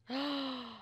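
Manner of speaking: soft, drawn gasp of delight; excited gasp. Real, not acted. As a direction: gasp in happy amazement. Still thrilled by the find.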